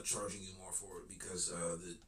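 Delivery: ordinary talk, nothing marked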